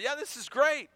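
put-on high, excited young crowd cheering; giddy celebration, high and bright with joy. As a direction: voice the young people overjoyed to be home. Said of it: "celebrating and cheering".

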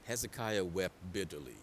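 low, soft repeat for weight; grave, quiet preaching; hushed solemnity. Real, not acted. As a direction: drop right down and let it sink in.

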